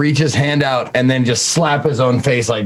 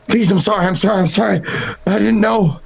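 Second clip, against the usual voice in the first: higher, panicky pleading; frantic begging; 7 semitones higher than usual. Acted. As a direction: plead in terror as you rock. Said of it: "mumbling and kinda rocking".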